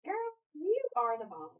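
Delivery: high-pitched, admiring cheer; gushing praise. Real, not acted. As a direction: praise the student in a high swoop. Teacher cheering on her student.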